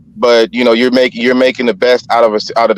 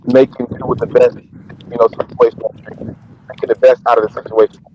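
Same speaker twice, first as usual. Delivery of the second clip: underwater. Real, not acted. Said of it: "you sound like you're underwater right now".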